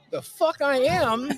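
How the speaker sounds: slow, high, scoffing comeback; indignant retort. Retold or imitated. Fellow YouTuber says they're millionaires, mock-indignant scoff. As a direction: drag it out in disbelief.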